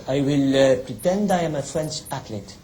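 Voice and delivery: light mock French accent; a playful hint of French accent. Frenchman plotting to pose as an athlete, mock French accent. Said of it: "a Frenchman".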